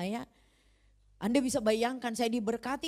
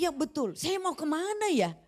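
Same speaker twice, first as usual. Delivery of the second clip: sudden realisation; 7 semitones higher, 4 dB louder than usual. Retold or imitated.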